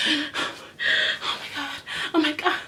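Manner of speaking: high, fast, overdramatic fretting; mock panicked drama queen. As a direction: ham up her rose panic. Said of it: "she go".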